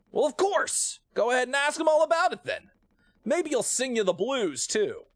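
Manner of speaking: high, mocking, sarcastic lilt; a lightly voiced snarky crewmate; sarcastic character. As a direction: scoff at the idea. Voicing sarcastic game character.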